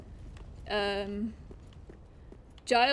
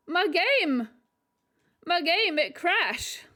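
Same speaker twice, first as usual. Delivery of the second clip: higher, louder wail over a crash; distressed wail; 5 semitones higher, 3 dB louder, much slower than usual. Real, not acted. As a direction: cry out at the broken game. Game crashed, lament.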